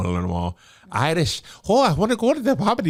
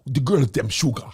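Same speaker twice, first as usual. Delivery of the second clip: put-on London accent; 11 semitones lower than usual. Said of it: "on Top Boy?".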